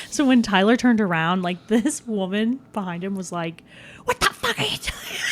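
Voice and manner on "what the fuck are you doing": high, strained mock yell, held back in volume; imitated angry yell. Imitating the woman behind him at the concert, mock yelling. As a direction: mimic an outraged stranger in a high, softened squawk. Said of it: "like, yelling at him".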